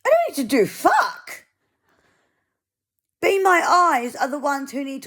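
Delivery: furious refusal; indignant. Real, not acted. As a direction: snap back in real anger. Angry retort to the volunteer.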